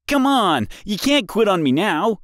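high, loud pleading yell; frustrated shout. A narrator's reading. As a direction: yell at the broken radio. Boy yelling at dead radio.

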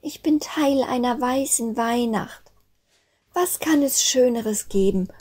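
high little snowflake voice, marvelling; dawning realization. A narrator's reading. Snowflake understands she is part of Christmas, high wondering voice. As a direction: say it as a child's wondering discovery. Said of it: "öffnete Sternenklar die Augen und begriff".

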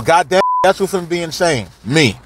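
ordinary delivery

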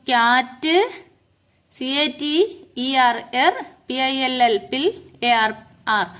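teacher breaking "ക്യാറ്റ്-എർ-പിൽ-ആർ" into spelled syllables. Shown as a demonstration. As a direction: spell and sound out "cat", "എർ", "പിൽ", "ആർ", each apart. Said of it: "ഇതിനെ ഓരോന്നിനെയും ഓരോ syllable ആയിട്ടാണ് പറയുന്നത്".

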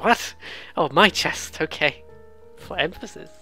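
ordinary talk

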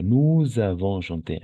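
"Nous avons" linked with a z sound, slowly; marked liaison. Shown as a demonstration. Teacher models the past tense for a pupil, marked liaison. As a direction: stretch "Nous avons" into "nou-zavons". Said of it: "avec la liaison".

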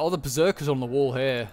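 ordinary delivery

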